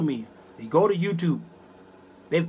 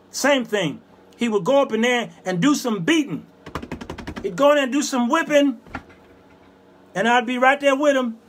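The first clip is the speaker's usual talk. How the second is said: high, fervent preacher's delivery; preaching; 7 semitones higher, 3 dB louder than usual. Real, not acted. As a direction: preach it with rising fervor. Preaches on Jesus and money changers.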